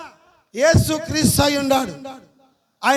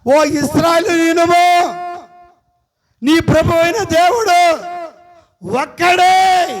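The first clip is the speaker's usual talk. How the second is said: solemn proclamation; 5 semitones higher, 7 dB louder, slower than usual. Acted. Proclaims scripture verse.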